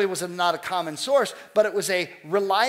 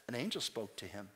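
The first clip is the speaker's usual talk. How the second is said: hushed awed bystander; 8 semitones lower, 14 dB quieter than usual. Retold or imitated. Crowd quoted.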